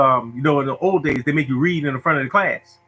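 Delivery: normal talking voice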